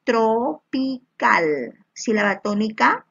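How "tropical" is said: "Tropical" slowly broken into syllables. Shown as a demonstration. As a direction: stretch and separate each syllable of "Tropical". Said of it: "A ver, separa".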